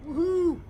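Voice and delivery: high, loud "woohoo"; celebratory whoop. Real, not acted. Player sees profit in a trade, high celebratory whoop.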